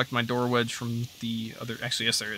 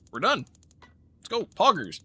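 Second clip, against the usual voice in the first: excited cheer; 7 semitones higher than usual. Real, not acted. Cheering a cleared level.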